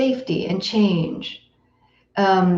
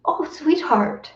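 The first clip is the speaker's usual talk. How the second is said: a higher, soft "Oh, sweetheart" to yourself; tender self-comfort; 8 semitones higher, 3 dB quieter than usual. Retold or imitated. Teacher showing students how to comfort their own pain tenderly. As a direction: soothe yourself like a hurting child. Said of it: "It means".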